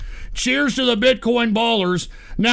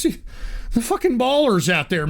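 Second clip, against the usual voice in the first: ordinary talk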